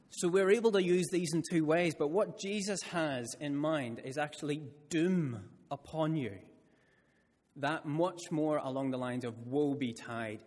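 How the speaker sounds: ordinary talk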